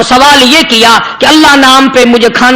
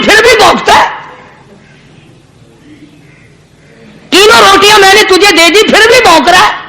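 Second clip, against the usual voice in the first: mildly acted indignant scolding, pitched higher; angry saint; 6 semitones higher than usual. Saint rebuking the barking dog, acted anger. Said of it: "غصے میں آ گیا اور کہنے لگا".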